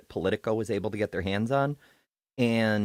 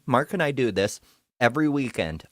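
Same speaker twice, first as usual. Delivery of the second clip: usual speaking voice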